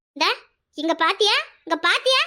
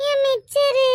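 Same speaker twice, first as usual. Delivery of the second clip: drawn-out crying whine; tearful wail; 3 semitones higher, much slower than usual. Acted. Child whose snack was snatched, crying.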